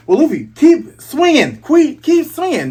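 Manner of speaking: high, loud excited cheer. Real, not acted. Viewer cheering Luffy on to keep swinging, loud and high. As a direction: urge him on at the top of your voice.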